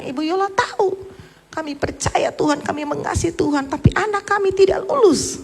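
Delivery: high, pleading voice of a mother near tears; grieving mother's tearful plea, retold; imitating a weeping mother. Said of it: "dia menangis, dia bilang".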